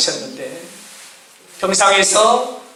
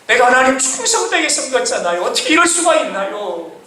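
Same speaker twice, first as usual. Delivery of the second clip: acted protest to God, pitched up; resentful complaint; 6 semitones higher than usual. Sick father questions God over his illness, resentful complaint. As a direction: plead and object, as if wronged by God. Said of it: "원망하고".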